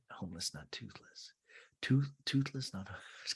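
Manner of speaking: very soft, halting rehearsal; mock confused murmur to oneself; imitated self-muttering. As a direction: puzzle it out under your breath. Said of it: "I have to say it in my head".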